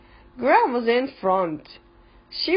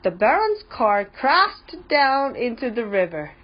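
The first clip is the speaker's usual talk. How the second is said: usual speaking voice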